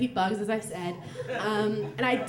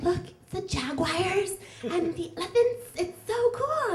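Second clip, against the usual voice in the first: put-on much higher, bright kid voice showing off videos; high-pitched child gushing about jaguars; excited little girl; 8 semitones higher than usual. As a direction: play the thrilled ten-year-old sister. Ten-year-old host sister quoted.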